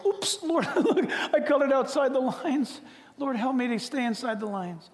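high, laughing oops prayer; a giggling child caught coloring wrong; sheepish little prayer with a laugh; praying sheepishly. Retold or imitated. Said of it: "we go".

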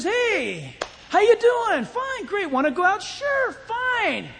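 high, sing-out mock small talk; eager flirting couple. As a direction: play a gushing, too-easy pickup exchange. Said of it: "Somebody comes up to you and says".

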